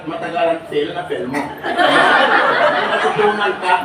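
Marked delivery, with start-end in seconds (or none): 1.4-3.7 stretched very slowly, louder, with a laugh; drawn-out slow. Shown as a demonstration. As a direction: drag each syllable to show the slow way. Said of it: "Mabilisan o matagalan?". (dahan-dahang binabanat)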